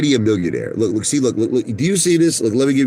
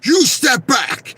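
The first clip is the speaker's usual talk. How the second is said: slow, much higher shouted warning from a bodyguard; bodyguard shouting; 13 semitones higher, much slower than usual. Retold or imitated. Bodyguard shouting at the fan near Don King, slow high warning. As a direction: bark it again, pushing him away. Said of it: "They go".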